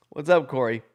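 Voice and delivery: ordinary talk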